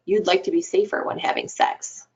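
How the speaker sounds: whispered, gentle guess; a hushed, careful statement; soft reflection. Shown as a demonstration. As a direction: offer it softly, barely voiced. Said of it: "So you might say".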